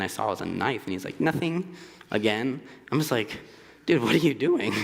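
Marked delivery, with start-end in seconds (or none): 1.2-1.7 innocent nothing. Retold or imitated. Roommate hides knife, says nothing. 3.9-4.7 alarmed question. Retold or imitated. Confronting roommate with knife.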